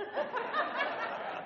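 a short, high burst of laughter. Real, not acted. Preacher laughing high at his own dog story.

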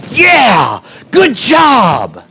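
high, drawn-out celebratory shout; cheering welcome. Acted. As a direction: cheer them in like a winner. Imagined cheer on arriving in heaven.

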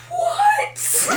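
squeaky high shocked what; mock surprise. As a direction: shoot up high in fake shock. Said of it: "And you just go".

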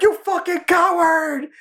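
high, slow, stretched mocking yell. Mechanic screams after the fleeing pilot, stretched high yell. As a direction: drag out the insult at full pitch.

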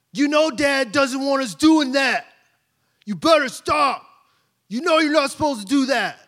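high, loud mock bossy big brother; imitating Elijah. Preacher mimics son scolding his siblings, high and bossy. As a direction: ham up a tattling older son. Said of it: "I don't sound like that".

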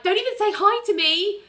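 high-pitched snub in passing; mock huffy. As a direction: toss it off as you storm past. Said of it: "storms past Ali and is like".

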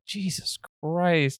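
slow, soft, drawn-out exasperation; slow stunned disbelief at the claw marks; dismayed mutter. Retold or imitated. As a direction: let it out slowly, stunned and weary.

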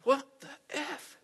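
incredulous outburst. Retold or imitated. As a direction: imitate the owner's shocked high cry. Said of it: "And he goes".

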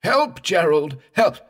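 terrified scream for help; burning scream. A narrator's reading. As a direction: scream in agony and terror. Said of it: "prolonged scream of agony and terror".

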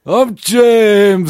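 high, loud mock northern voice; mock northern impression. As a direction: play a cartoon northerner. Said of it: "Let's do an impression of James".

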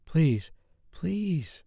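high, begging woman's voice; breathless begging; pleading. A narrator's reading. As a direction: plead with him. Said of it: "pleading mess".

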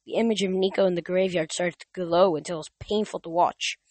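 plain reading voice

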